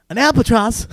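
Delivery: put-on Australian accent. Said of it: "I can't do an Australian accent".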